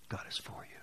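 whispered assurance. Real, not acted. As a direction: breathe it to them softly. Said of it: "whispered closing".

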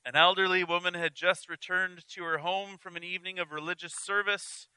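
ordinary talk

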